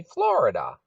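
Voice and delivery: ordinary delivery, nothing marked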